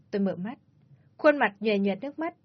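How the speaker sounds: ordinary delivery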